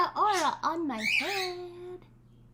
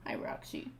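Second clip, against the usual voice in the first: whisper; 10 dB quieter than usual. Real, not acted. Greeting the parrot softly.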